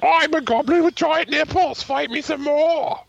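high, loud squeaky goblin. Acted. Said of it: "It's your goblin voice".

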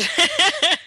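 short, high, loud giggle; embarrassed laugh. Real, not acted. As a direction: burst out laughing mid-story. Laughs at own embarrassing story.

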